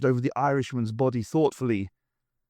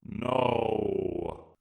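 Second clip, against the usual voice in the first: slow, cold alien command; Dalek Emperor order; 2 semitones lower, 4 dB quieter than usual. Acted. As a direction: order it like a machine ruler, slow. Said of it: "replied the Emperor".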